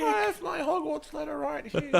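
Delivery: put-on very high squeaky kid voice; mock little boy. Friend jokingly playing an eleven-year-old boy, squeaky high. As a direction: pipe it up like a small boy.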